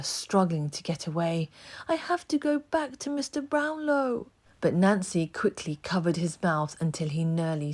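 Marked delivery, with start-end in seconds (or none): 1.9-4.3 put-on desperate boy. A narrator's reading. Oliver pleads.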